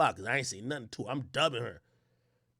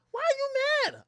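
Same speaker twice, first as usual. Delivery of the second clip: put-on much higher, innocent girly voice; mimicking girlfriend. Narrator imitating his date asking why he's mad. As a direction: ask it sweetly like her. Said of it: "she's like".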